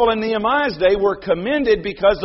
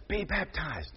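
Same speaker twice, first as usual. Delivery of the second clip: softer, hushed grandfather cue; soft prompt; 3 semitones lower, 13 dB quieter than usual. Retold or imitated. Grandfather prompting Luke softly. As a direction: murmur it as a prompt. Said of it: "you can hear his grandfather say".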